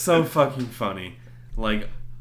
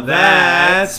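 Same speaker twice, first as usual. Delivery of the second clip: hype announcer; 6 semitones higher, 10 dB louder than usual. Acted.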